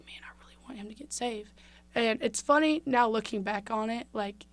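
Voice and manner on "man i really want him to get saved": soft whisper of longing; whispered wish. Retold or imitated. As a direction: whisper it as if to yourself. Silent wish from backstage.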